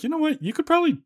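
squeaky falsetto impression; high excited egging on; high mock voice. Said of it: "They're like".

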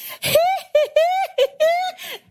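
very high, drawn-out mock cackle; squealing "hee hee" laugh, put on; imitated hysterical laugh. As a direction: mimic someone laughing helplessly at you. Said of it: "this hysterical laugh".

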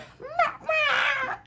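high squeaky dolphin-like cry; squeaky howl. Shown as a demonstration. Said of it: "you sounded like a dolphin".

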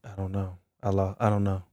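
trailing off, soft and low; barely audible, half to herself; quiet mumble. Real, not acted. Speaker mumbling softly.

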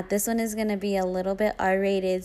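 ordinary delivery

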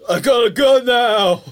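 gleeful shout; loud character voice. Pretend. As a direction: yell it like a movie hero. Voicing character on screen.

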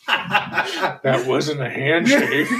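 laughing through the words; slow, breaking into laughter. Real, not acted. Laughing at crude joke.

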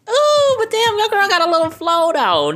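loud, high hyped reaction; excited. Real, not acted. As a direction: hype yourself up, loud and high. Hyped reaction to own rap.

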